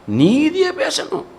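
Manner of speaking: high, drawn-out stress on நீதியை; emphatic teaching point. Shown as a demonstration. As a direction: hold the first syllable of நீதியை high and slow. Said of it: "நிதானமா எப்படி பேசணும் தெரியுமா?".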